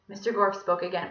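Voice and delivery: ordinary delivery, nothing marked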